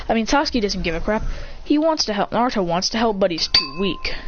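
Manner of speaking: ordinary delivery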